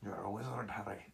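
lightly voiced Hagrid, dropping soft at the end; a quiet hint of Hagrid; quoting the famous line in a soft Hagrid voice. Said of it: "tells Harry the line".

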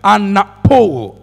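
anapo drawn out slowly, syllable by syllable; Greek word spoken slowly. Shown as a demonstration. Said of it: "It's a Greek word".

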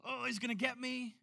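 lightly mocking scared voice; mock terrified. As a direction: play a cowering believer. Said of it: "it's kinda like this look like".

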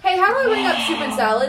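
high, loud, quick, laughing through it; laughing mock question. Real, not acted. Server mocking a new coworker's question, laughing. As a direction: crack up while quoting the clueless newbie.

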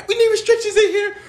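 high, loud cry for backup; comic emergency holler; mock alarmed call. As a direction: shout for staff, hammed-up. Acts out staff calling for help.

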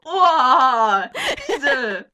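loud, laughing shocked oh; loud laughing outcry. Real, not acted. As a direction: burst out laughing in disbelief.

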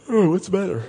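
high, unbothered what's the matter; breezy, untroubled question; calm Jesus. Pretend. Jesus woken on the boat, lightly unbothered.

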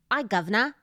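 light Cockney "Aye, governor", rising at the end; a quick British line when asked; British accent on request. Shown as a demonstration. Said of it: "that, and that was good".

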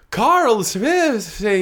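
high, loud, slow gasp of the name; horrified surprise. Acted. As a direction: stretch the name in shock. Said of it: "and Misty".

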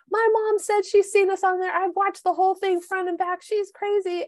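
imitating a friend who just spotted the photo, excited; excited retelling. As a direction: gush it out like you can't believe it. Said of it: "You're like".

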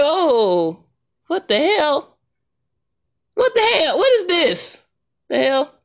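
high, rising shocked what the hell; shocked disbelief. Retold or imitated. Vlogger reacting to [name]'s note in high disbelief. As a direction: pitch climbing as the shock hits. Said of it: "I was like".

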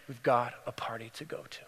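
low, breath-only whisper; tender whisper. Acted. Father repeating it to his daughter, whispered tenderly. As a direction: whisper it to her like a loving secret.

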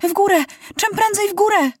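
urgent shout. A narrator's reading. Maciuś orders the pilot up in alarm, high urgent shout. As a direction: yell it urgently. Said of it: "natychmiast krzyknął".

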